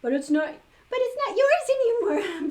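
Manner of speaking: high, loud protest; high shrill objection. A narrator's reading. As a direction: insist the hat isn't his. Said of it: "protested Karen".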